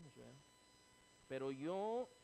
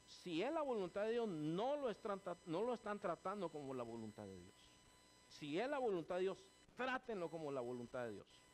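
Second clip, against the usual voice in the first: retold heated rebuke; 6 semitones higher, 7 dB louder than usual. Pastor retells rebuking American leaders.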